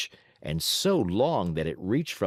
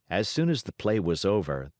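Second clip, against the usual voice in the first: ordinary delivery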